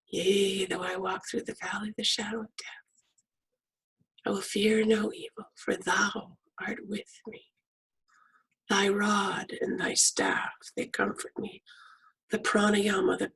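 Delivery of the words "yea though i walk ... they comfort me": soft whispered psalm over the breath; hushed meditative psalm reading; guided meditation recital. Acted.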